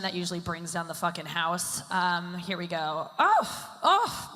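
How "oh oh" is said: short high, loud "oh"s; high-pitched celebrity impression; Jennifer Aniston. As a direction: squeal little high ohs in a put-on voice. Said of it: "I can do a Jennifer Aniston impression".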